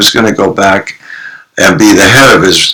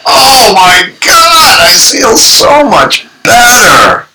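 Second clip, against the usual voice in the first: relieved exclaim; slower than usual. Retold or imitated. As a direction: call out the relief, drawn out and high. Group members after the catharsis.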